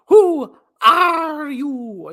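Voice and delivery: very slow, high, loud wail; mock anguished cry. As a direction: ham up a tragic cry. Mock dramatic cry from movie.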